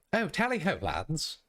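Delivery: pip-pip posh British officer, hammed-up; British accent sample. Shown as a demonstration. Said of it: "what accent am I doing for this".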